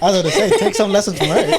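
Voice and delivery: loud laughing tease. Real, not acted. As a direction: joke loudly through a laugh. Teasing with laughter.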